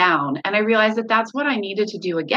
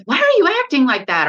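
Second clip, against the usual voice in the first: scolding; 6 semitones higher, 6 dB louder than usual. Retold or imitated. Retold scolding of upset kids.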